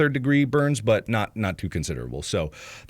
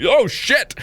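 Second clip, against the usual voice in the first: startled curse; 12 semitones higher, 4 dB louder than usual. Retold or imitated. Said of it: "it's like".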